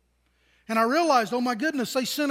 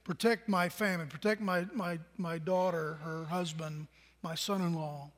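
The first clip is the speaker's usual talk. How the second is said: hushed, softer pleading prayer; praying softly; 5 semitones lower, 8 dB quieter than usual. Retold or imitated. Father praying for daughter in London, hushed and pleading. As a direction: plead quietly with God for family. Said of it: "I'm saying, God".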